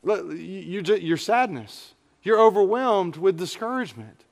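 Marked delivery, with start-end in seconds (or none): none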